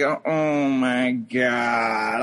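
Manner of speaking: very slow, low drawn-out groan; mock disbelief. Bystander at Starbucks watching the row, drawn-out disbelief. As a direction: stretch every word out in low dismay. Stunned bystander reaction, retold.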